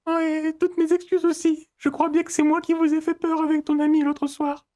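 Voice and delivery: voix de fausset très haute et monocorde; excuse-toi d'une petite voix perchée; autre voix de personnage. Acted. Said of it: "on va trouver une autre voie". (voix composée, suraiguë, tenue tout du long)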